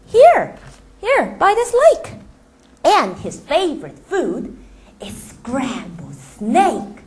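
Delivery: slow, lightly voiced mouse boasting. The mouse scaring the snake with the Gruffalo, boasting. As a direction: list the monster's features slowly.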